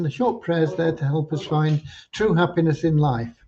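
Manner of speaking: ordinary talk, nothing marked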